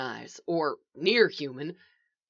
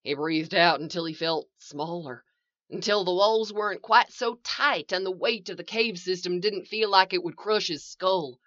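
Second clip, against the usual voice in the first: usual speaking voice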